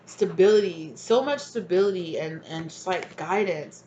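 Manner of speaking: ordinary talk, nothing marked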